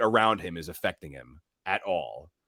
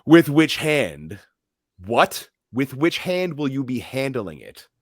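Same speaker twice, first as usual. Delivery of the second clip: raised, drawn-out mock questioning; pointed challenge; 5 semitones higher, 6 dB louder, slower than usual. Chef challenging the guest who will handle it, pointedly. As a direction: lean slowly on the question, pitched up. Acted challenge in retold scene.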